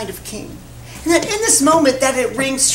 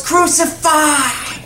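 long, higher-pitched mob cry; crowd yell; 5 semitones higher, much slower than usual. Acted. The crowd still demands a death, yelling. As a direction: call it out slowly as a mob. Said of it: "The crowd still yells".

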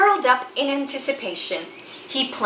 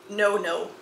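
ordinary delivery, nothing marked

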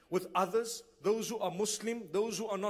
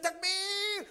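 high, loud cry of Takbir; mock zealous shout of Takbir; 10 semitones higher than usual. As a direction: shout it out to the crowd. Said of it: "so loudly".

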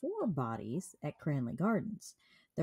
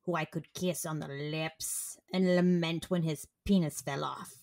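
evil sci-fi character. Acted. Host reading a killer's reflection to her co-host, as an evil sci-fi character. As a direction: intone it like a sci-fi villain. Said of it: "talking like an evil sci-fi character".

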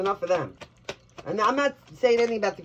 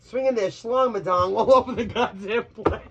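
laughing through it. Real, not acted. Laughing through crude tarot reading.

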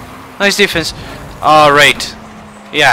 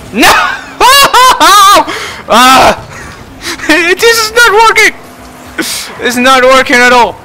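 laughing shout; 11 semitones higher than usual. Real, not acted. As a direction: laugh and yell at once through the failure. Failing play, laughing shouts.

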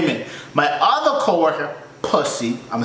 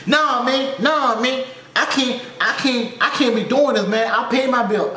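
whiny customer impression; 8 semitones higher than usual. Customer protesting his bill.

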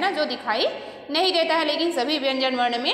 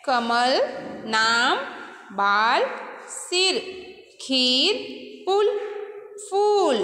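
teacher reading word list aloud; reading aloud to children; much slower than usual. Shown as a demonstration. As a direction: read the word list for the class. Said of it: "फिर से".

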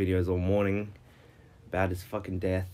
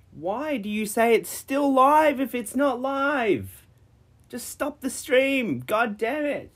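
frustrated rant. Real, not acted. Streamer yelling at Twitch for cutting the stream, high exasperated rant. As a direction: pitch shot way up in real outrage.

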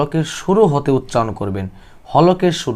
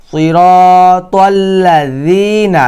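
slow, drawn-out verse recitation; Quran recitation; 4 semitones higher, 6 dB louder, much slower than usual. Shown as a demonstration. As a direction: recite slowly, stretching the long vowels and the final syllable.

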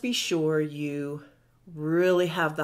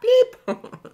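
playful bloop; 9 dB louder than usual. Pretend. Skin plumping sound imitated.

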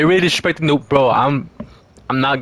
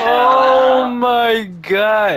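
laughing; 9 semitones higher, much slower than usual. Real, not acted. After shocked reaction.

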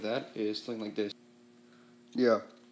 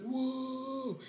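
high, hushed whoa; retold amazement; amazed whoa; 11 semitones higher, 7 dB quieter than usual. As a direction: breathe it out in wonder. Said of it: "I'm like".

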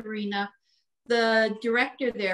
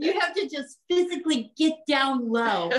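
usual speaking voice

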